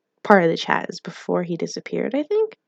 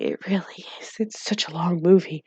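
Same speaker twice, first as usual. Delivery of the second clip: whisper; 5 dB quieter than usual. Real, not acted. Whispered aside agreeing about movie.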